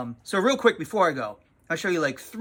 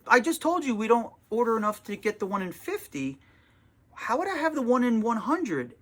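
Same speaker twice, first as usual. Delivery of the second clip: exasperated, a touch higher, explaining the obvious; retold snippy tone; 7 semitones higher than usual. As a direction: let the impatience leak through. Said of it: "maybe the tone of my voice".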